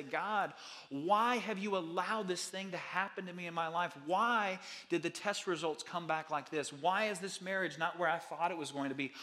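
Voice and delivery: a grieving believer railing at God, growing to a cry; lashing out at God. Pretend. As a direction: demand answers from God in hurt anger. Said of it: "lash out at God".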